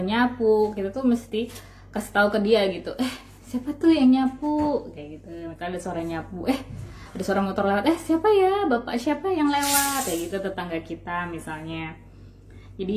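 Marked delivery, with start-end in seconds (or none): 3.0-4.9 higher, slow and wondering; curious parent. Acted. Mother asking her child who is sweeping, higher and wondering. 6.5-10.1 jumps much higher on siapa ya; curious parent. Acted. Mother asking her child who is passing by, high and amazed.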